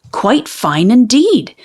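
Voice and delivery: ordinary delivery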